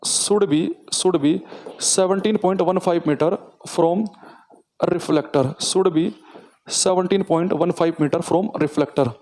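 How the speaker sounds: very slow, word by word; slow dictation. Shown as a demonstration. Teacher dictating the English version slowly. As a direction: dictate each word for notes. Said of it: "तो वो दूरी मैं लिखवा देता हूँ".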